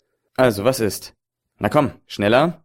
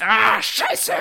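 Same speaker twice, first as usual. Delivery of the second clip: pained cry muffled by a helmet; high yelp of pain behind a visor; inside a helmet; 10 semitones higher than usual. Acted. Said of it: "Hört ihr noch durch den Helm gedämpft".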